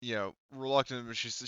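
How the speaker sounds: ordinary talk, nothing marked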